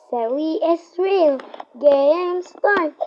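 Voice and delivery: put-on slow, high, drawn-out kid chant; sing-song intro. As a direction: stretch it out like a goofy show intro.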